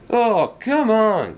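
high, slow, drawn-out exasperation; cross. A narrator's reading. Engine crashed in his own trap complains, cross. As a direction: stretch it out in cross disbelief. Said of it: "said Duncan crossly".

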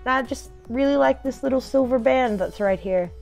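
normal talking voice

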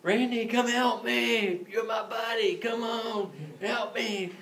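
high-pitched, drawn-out pleading cry; mock yell for help. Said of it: "he's yelling at me".

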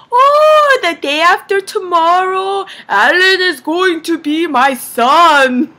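high, loud, drawn-out proclamation; mock-grand gushing dad impression; imitated elated dad. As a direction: announce it proudly, stretching the last words. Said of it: "my dad is like".

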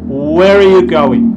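"Where are" run together as "where-uh", loud and drawn out; "are" shrunk to uh, emphatic teaching delivery; reduced "are". Shown as a demonstration. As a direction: demonstrate linked "Where are" as "where-uh", stretched for learners. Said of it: "aquí vas a escuchar".

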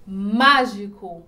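"Mágico" slow, MÁ loud and held; stress on MÁ. Shown as a demonstration. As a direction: punch and stretch the start of "Mágico". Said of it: "A sílaba mais forte está na antepenúltima". ("Mágico" devagar, com o MÁ bem forte)

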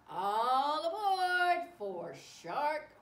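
loud, drawn-out train conductor call; conductor's call. Acted. Reader calls children aboard for the story, loud conductor's call.

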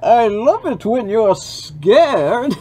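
high, loud, slow villain; Bane voice. Acted. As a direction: ham up Bane, stretching it out. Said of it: "Bane was way better choice".

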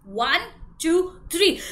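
usual speaking voice

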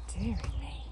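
ordinary delivery, nothing marked

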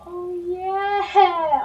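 loud triumphant oh yeah; excited shout. Real, not acted. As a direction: celebrate the win out loud. Joyful shout in game.

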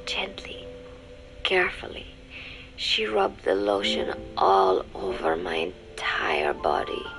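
breathy, whispery narration with long pauses; hushed, spellbound. A narrator's reading. Woman recalling being rubbed with lotion, hushed and spellbound. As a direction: drift softly, as if in a trance. Spellbound recollection of the intimate moment.

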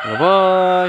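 high loud sing-song bye; loud goodbye. Real, not acted. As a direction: shout a cheery goodbye.

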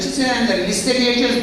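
ordinary talk

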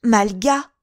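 Sarah seeing Malga appear; horrified cry. Acted. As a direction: cry out at a ghost. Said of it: "s'écria-t-elle".

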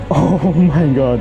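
usual speaking voice

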